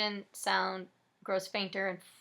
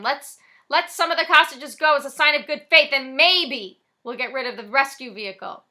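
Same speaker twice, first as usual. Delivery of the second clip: loud, raised call from outside; cop yelling; 4 semitones higher, 12 dB louder than usual. Acted. Cop yells to the robbers, loud. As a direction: shout the offer across the street. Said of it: "the cop yells again".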